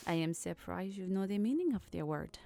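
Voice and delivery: put-on Old French accent. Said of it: "She goes back to speaking in Old French".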